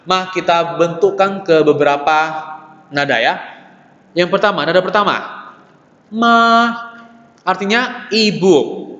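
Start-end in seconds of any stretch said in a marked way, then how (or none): 6.1-6.3 "ma" held high and level; high, flat Mandarin first-tone "ma". Shown as a demonstration. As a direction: stretch "ma" on one steady high note. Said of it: "baca tinggi dan rata".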